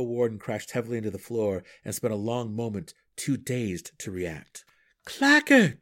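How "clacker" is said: high, loud shout of a name; urgent cry. A narrator's reading. Drizzt calls to Clacker mid-fight, urgent cry. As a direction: call out sharply, much louder. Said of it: "Driz again cried".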